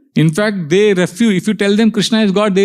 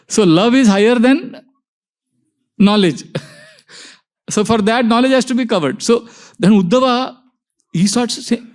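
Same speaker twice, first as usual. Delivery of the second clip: ordinary talk, nothing marked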